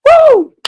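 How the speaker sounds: high, loud joyful whoop; celebratory whoop. Real, not acted. Fan whooping at a goal, ecstatic. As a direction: let out a wild woo.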